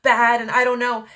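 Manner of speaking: high, fast, anxious fretting; hammed-up worry about an unknown place; mock panic. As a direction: fret like a lost traveller. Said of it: "I'm, like, stressing".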